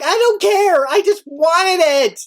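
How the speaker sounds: high, slow desperate wail; retold panic over the lost katana; frantic outburst. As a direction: plead like the parcel is everything. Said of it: "and I'm like".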